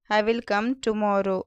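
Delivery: usual speaking voice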